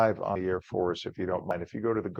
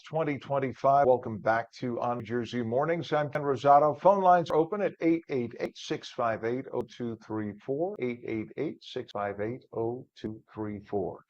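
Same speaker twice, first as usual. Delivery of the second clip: ordinary talk, nothing marked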